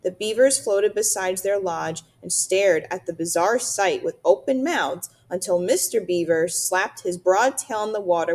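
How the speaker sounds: plain reading voice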